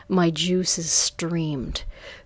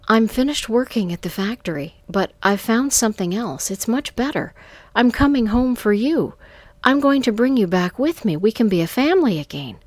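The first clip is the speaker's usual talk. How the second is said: loud, raised, fast and eager; excited good news; 5 semitones higher, 7 dB louder, faster than usual. A narrator's reading. Man phoning home with big plans, excited. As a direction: rush out the happy news.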